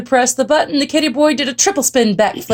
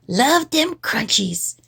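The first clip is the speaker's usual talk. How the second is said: slow, softer kitten voice; kitty boy; 3 semitones higher, 5 dB quieter, slower than usual. A narrator's reading. Kitty boy enjoying his crunchy fly, slow relish. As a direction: savor it slowly in character. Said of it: "exclaimed the kitty boy".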